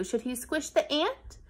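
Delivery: usual speaking voice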